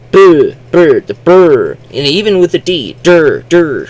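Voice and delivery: "burr" and "der" drawn out, high and loud; isolated sound demo. Shown as a demonstration. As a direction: punch out "burr" and "der" as separate syllables. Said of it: "combinations of many consonants together".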